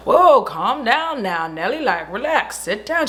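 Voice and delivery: slow imitated Texan drawl, lightly voiced; Southern drawl. The Texan man telling Sally to sit down, mock drawl. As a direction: ease the words out like a mock Southern gentleman. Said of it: "true Texan, Southern gentleman".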